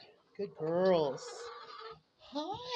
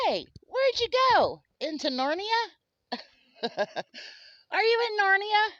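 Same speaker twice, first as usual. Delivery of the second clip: playful pet talk; 14 semitones higher, 8 dB louder, slower than usual. Real, not acted. Owner calls to her hidden bird in high playful baby talk. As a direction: tease the hiding pet playfully. Teasing pet hiding in brush.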